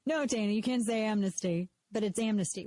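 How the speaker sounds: ordinary talk, nothing marked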